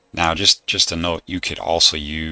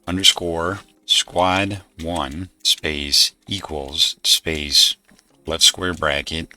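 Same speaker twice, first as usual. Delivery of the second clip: dictating. Shown as a demonstration. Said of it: "and this is the code".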